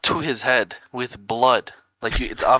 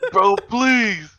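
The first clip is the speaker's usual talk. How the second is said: laughing plea; 7 semitones higher than usual. Retold or imitated.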